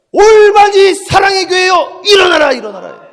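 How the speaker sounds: rally the congregation like a battle cry; commanding shout. Acted. Pastor calling his church to rise, shouted command.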